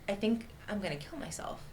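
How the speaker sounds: soft, understated matter-of-fact quote. Retold or imitated. Volunteer to candidate after the blue wall falls, matter-of-fact. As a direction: quote her quietly, as a plain statement of fact. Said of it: "she said it so just ... Matter of factly".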